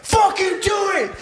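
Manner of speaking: high mock shouting; yelling dare. Imitating friend yelling back in the theater, yelling dare. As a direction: egg him on at a yell. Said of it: "now we're yelling at each other".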